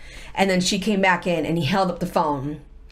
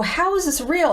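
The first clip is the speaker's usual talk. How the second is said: disbelief; 9 semitones higher than usual. Retold or imitated. Retelling her disbelief.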